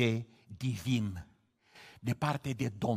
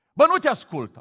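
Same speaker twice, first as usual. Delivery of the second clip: higher, louder hammed-up brushoff; mock scolding God; 10 semitones higher, 9 dB louder than usual. Believer telling God off, mock. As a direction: snap at God like a rude kid. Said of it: "Asta e o rugăciune".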